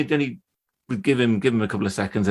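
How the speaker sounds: ordinary delivery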